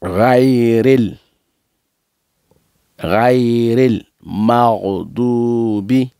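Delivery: slow, measured Quranic recitation; deliberate teaching recitation with held vowels; recitation together. Shown as a demonstration. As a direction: lead the students, drawing out each long syllable. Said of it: "on va ensemble".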